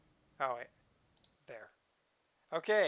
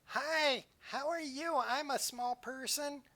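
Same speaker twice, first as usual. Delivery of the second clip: high small voice; 9 semitones higher, slower than usual. Pretend. Playing tiny character.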